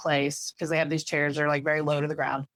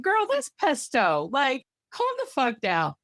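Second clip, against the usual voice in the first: sassy reassurance; 7 semitones higher than usual. Retold or imitated. Calming friend about pesto.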